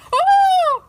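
very high, loud scream; piercing high scream of fright. Acted. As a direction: shriek in terror.

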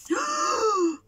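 high, drawn-in storytelling gasp; acted intake of breath; astonished gasp. Gasp at the talking bear.

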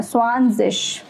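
स्वानजिश slowly, in two parts, ending on इश; pronunciation to memorize. Shown as a demonstration. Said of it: "आपको ये याद करना है". (स्वानजिश, याद कराने के लिए धीरे-धीरे)

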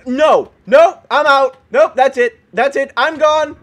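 loud, rapid-fire protests; alarmed refusal. Real, not acted. Gamer refusing to face a clown mob, alarmed refusal.

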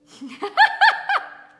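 loud, high burst of laughter; big laugh. Real, not acted. Host teasing Laura about lumberjacks, loud high laughing.

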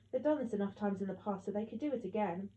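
ordinary delivery

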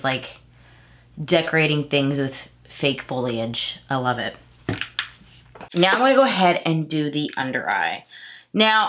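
ordinary delivery

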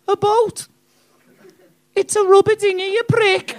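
high, loud, scornful mock Scouse girlfriend; bad Liverpool accent. Girlfriend correcting boastful heckler, shrill bad Scouse scorn. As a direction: screech it in a badly faked Liverpool accent. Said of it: "worst excuse at your accent".

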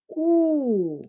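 У sung out long and loud; stressed vowel sound. Shown as a demonstration. Teacher names the vowel for children, drawn out. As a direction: hold the vowel У clearly. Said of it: "наголошується звук".

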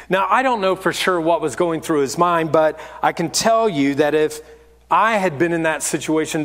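normal talking voice

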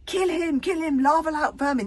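high, loud battle cry. A narrator's reading. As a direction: shout for blood like an angry mob.

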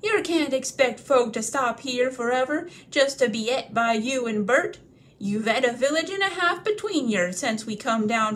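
loud, rough troll voice, aggrieved; grumbling troll. Acted. William scolding Bert about eating, loud and coarse.

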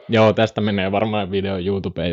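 usual speaking voice

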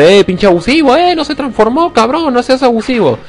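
high-pitched mock protest; mock complaining viewer. Player mimicking viewers calling him abusive, mock complaint. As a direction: imitate a whiny objector, voice climbing very high. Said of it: "uno dirá".

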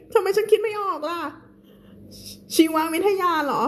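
high, fast flustered whine; playful whine. Real, not acted. Teacher pleading with viewers not to find her annoying. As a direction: act sheepish and a bit whiny. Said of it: "หน้าหมั่นไส้ไหม".

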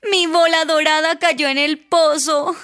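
whining, weepy princess voice; tearful princess. Acted. Princess telling the frog her ball fell, weepy wail. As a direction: wail it like a crying storybook princess. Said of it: "Llora la princesa Petunia".

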